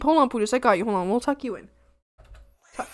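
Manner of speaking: usual speaking voice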